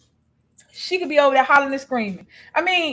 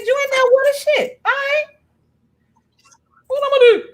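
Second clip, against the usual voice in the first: mock indignant retort; 11 semitones higher than usual. Acts out a retort to her roommate.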